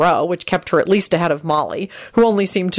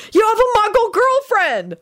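very high, drawn-out exasperated protest; exasperated shout. A narrator's reading. The group reminding Lupin of his advantage, exasperated. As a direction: cry out high and slow in exasperation. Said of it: "the group shouted in exasperation".

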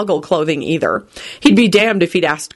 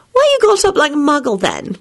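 higher-pitched character voice; nosy demand; 9 semitones higher than usual. A narrator's reading. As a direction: demand an answer at once. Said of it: "Rosie immediately demanded".

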